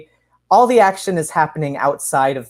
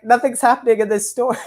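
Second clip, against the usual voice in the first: quick, higher, laughing through the line; laughing mock worry; 7 semitones higher, faster than usual. Host jokes about her own fear, laughing quickly. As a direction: play a scared writer, half laughing. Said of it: "I'd be like".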